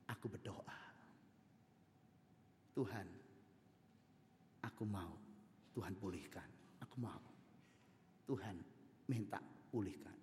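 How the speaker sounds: soft, low pleading prayer; quiet prayer. Acted. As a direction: pray gently for healing. Wife prays for restoration.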